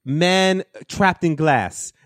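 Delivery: high, very slow mock Polish accent; botched Polish accent. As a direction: stretch each word in a fake Polish accent. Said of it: "I was doing a better accent of him earlier".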